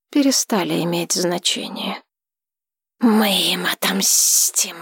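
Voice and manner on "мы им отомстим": slow hissing whisper. A narrator's reading. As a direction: hiss it like a dragon. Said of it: "прошипел дракон".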